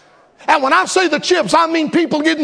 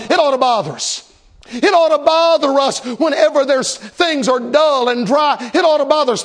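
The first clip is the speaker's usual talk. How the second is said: usual speaking voice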